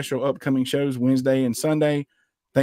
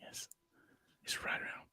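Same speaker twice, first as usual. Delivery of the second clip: hushed announcer whisper; golf whisper; 22 dB quieter than usual. Pretend. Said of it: "I had to use my golf voice".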